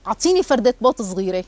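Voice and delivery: plain reading voice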